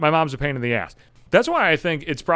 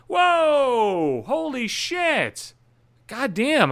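high, slow, sarcastic gasp of wonder; mock amazement; 10 semitones higher, much slower than usual. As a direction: fake being blown away by it.

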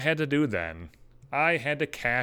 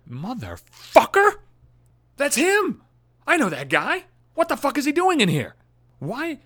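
shocked outburst; 12 semitones higher than usual. Retold or imitated. Seeing his ex's lover walk into his shop, shocked high outburst. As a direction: shriek it in disbelief, voice jumping high. Recognizing wife's lover in store.

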